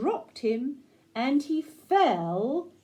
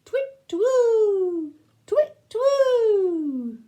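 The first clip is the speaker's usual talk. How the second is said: high, loud imitated owl hoot; owl call; 9 semitones higher, 7 dB louder, much slower than usual. Shown as a demonstration. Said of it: "what noise an owl makes".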